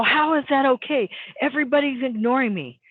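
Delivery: retold upset protest, pitched high. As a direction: imitate an upset, incredulous objection. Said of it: "very upset".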